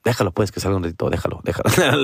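low, amused, quick dad voice letting him go; giggling dad. Retold or imitated. Dad giggles and lets his son go out, low and amused. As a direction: chuckle it off like an indulgent father. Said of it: "my dad would kinda giggle".

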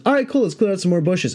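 casual buddy. Retold or imitated. Buddy quoted in a story.

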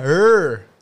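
strong rolled rrr. Shown as a demonstration. Showing how southerners roll the rrr in Tagalog. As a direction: trill the r hard. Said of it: "pag nagtatagalog sila".

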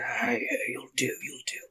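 quiet rough orc appraisal; gravelly half-orc. Acted. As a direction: size up workers under your breath. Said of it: "sometimes the gravelly voice takes a lot".

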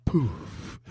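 slow whispered ad voice; whispering. Pretend. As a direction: breathe it like a secret ad line. Whispered mock ad voice.

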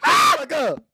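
high laughing shout of disbelief; shrieking shut the fuck up at a friend; disbelieving shout. Real, not acted. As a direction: yell it in stunned amusement.

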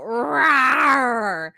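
loud playful mock roar; big rolling "rawr"; lion roar. Imitates the lion let out.

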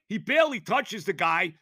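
ordinary delivery

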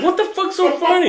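high, exasperated demand; mock-outraged high question; retold indignation. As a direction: confront someone laughing at you, pitch way up. Confronting his laughing sister.

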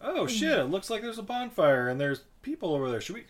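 high, eager whispery scheming; high excited mock-conspiring; excited campers. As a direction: egg each other on to go look. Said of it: "they're like".

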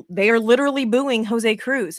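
emphatic re-enacted argument; acted insistence. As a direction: insist on it, mock-exasperated.

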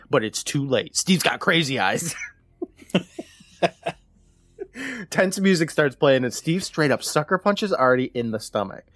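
ordinary delivery

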